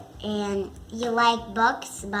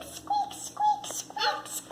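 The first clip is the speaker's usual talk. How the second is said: very high, tiny squeaks; repeated squeaky mouse imitation; 7 dB quieter, slower than usual. A narrator's reading. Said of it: "A mouse squeak".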